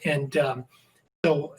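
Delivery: usual speaking voice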